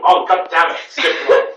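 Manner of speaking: usual speaking voice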